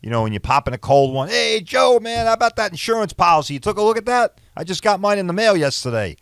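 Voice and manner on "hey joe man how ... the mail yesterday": high, casual mock buddy voice; chirpy pal asking about insurance; hammed-up chummy neighbor; buddy at a barbecue.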